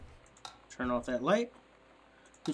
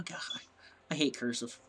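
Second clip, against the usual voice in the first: ordinary talk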